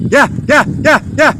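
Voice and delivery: sing-song call, coaxing dogs closer; high calling out. Real, not acted. Calling stray dogs over.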